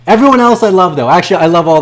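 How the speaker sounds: high, loud mock-loving shout; loud declaration. Real, not acted. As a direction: bellow the love. Turns to fans after rant.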